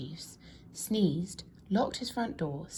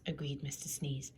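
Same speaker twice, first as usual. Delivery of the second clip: ordinary delivery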